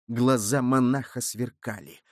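plain reading voice

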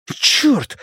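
high, urgent exclamation; high-pitched cry of shock; alarmed curse. A narrator's reading. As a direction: curse in sudden dismay. Friend has just been shot.

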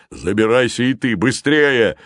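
urgent shout. A narrator's reading. Giant orders boy into basket, urgent shout. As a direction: order the boy aboard, building in force. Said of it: "крикнул Зефир".

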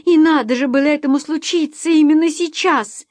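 high, loud lament; distraught outburst close to tears; almost crying. A narrator's reading. Mother lamenting to husband that it happened now, almost crying. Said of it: "Кэт почти плакала".

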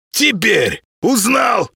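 triumphant creature shout. Acted. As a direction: shout it slowly like a gloating cat. Spirit turns into a cat.

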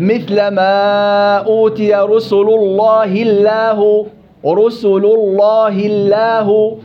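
very slow, drawn out, the lam of the first "الله" heavy and full; tajweed demonstration. Shown as a demonstration. Teacher correcting a student's recitation, very slow and deliberate. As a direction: stretch "رسل الله الله" slowly, first lam thick and the second thin. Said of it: "يجب أن تكون اللام مفخمة".

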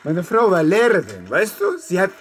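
high, loud, grief-stricken cry; anguished shout. Acted. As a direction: force it out as if he can barely say it. Widower defends his dead wife.

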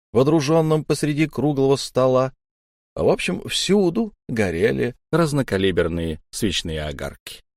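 ordinary delivery, nothing marked

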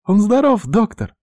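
put-on high-pitched, eager girl's question. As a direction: ask the doctor in a high, hopeful voice. Happy sister asks doctor. (с надеждой, высоко)

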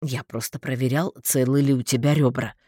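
low, dry reply; understated, matter-of-fact defence. A narrator's reading. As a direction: answer drily in a deep voice. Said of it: "сухо ответил Дарвальд".

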